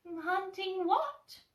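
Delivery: high, slow, curious Piglet; drawn-out squeaky question; tiny high piglet voice, slow. Acted.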